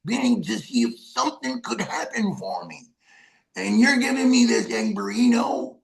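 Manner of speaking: put-on high, choked-up voice of an old toothless vet; old street vet, incredulous and cracking; old vet's disbelief. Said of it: "he looks at me and goes".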